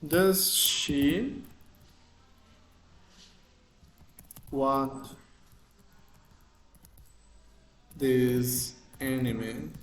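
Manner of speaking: slow English question, spaced word by word; slow example question. Shown as a demonstration. Teacher reading another example question slowly to students. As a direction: read each word slowly, clearly. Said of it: "Outro exemplo".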